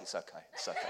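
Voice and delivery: low, soft mock reassurance; badly faked composure, hushed and low; feigned outward calm. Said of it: "Outside, I'm like".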